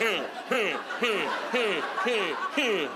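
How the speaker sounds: short repeated closed-mouth grunts; rhythmic grunting. Retold or imitated. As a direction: grunt in time with each thrust. Imitates grunts while bear grinds.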